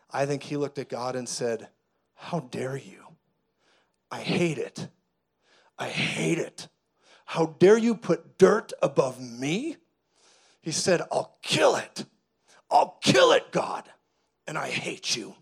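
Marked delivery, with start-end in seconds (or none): 2.1-6.7 devil's rage. Acted. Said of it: "the devil's rage erupted on the scene". 7.2-9.8 slow, loud, acted outrage; devil's rage. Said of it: "the devil's rage erupted on the scene". 11.1-15.3 devil's rage. Acted. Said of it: "the devil's rage erupted on the scene".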